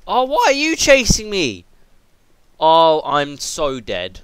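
loud high yelp, then slow dread; real panic; panicked cry. Real, not acted. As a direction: flee shrieking, then give up. Chased by mob.

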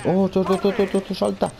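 high, rapid urging to jump; tense game call; excited urging. Real, not acted. Urging character to jump mid-fight.

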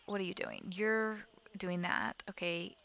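normal talking voice

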